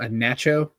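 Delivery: nacho with a short a as in cat; echoed British pronunciation. Retold or imitated. Podcaster queries co-host's word, British-style nacho. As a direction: say nacho like NATCH-oh, questioning. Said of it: "what'd you call it?".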